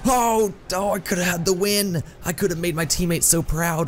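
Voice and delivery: high-pitched wail of dismay; anguished regret. Real, not acted. Gamer who could have had the win, dismayed. Just missed the winning shot.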